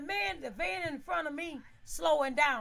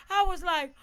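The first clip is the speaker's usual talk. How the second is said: normal talking voice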